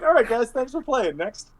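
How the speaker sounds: high, breezy brush-off; a hint of a chirpy host; mock dismissive. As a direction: wrap up the game cheerfully and dismissively. GM mock ends the game.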